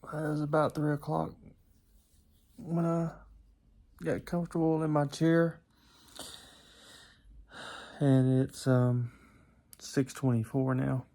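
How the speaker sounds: sleepy, groggy voice; drowsy just-woken mumble; just woke up. Real, not acted. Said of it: "I'm waking up from my nap".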